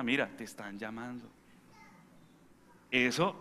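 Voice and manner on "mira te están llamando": soft, kind, lower voice; walk over and tell them gently; gentle request. Retold or imitated. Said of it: "ir hasta la persona".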